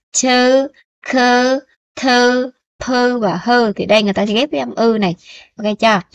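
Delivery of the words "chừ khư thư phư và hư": each syllable "chừ", "khư", "thư" set apart, clear and raised; syllable drill. Shown as a demonstration. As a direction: model the syllables for students. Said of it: "người ta đọc chữ này nè".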